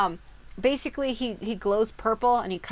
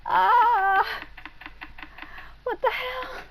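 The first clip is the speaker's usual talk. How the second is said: exasperated cry; 4 dB louder, slower than usual. Real, not acted. Exasperated laughing at herself.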